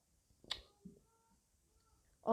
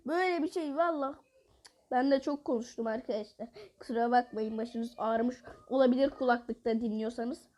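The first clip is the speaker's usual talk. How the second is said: ordinary talk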